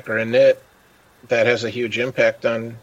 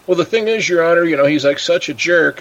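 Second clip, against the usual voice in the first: lightly voiced litigant pleading in a higher pitch; rambling litigant; 6 semitones higher, 3 dB louder than usual. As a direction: plead your grievance to the judge, pitched up a little. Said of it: "the person is like".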